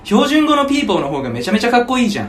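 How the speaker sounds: high, loud mock Tokyo accent; put-on standard Japanese. Shown as a demonstration. Praising the Tokyo siren, exaggerated Tokyo accent. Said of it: "標準語喋ろうと思うと".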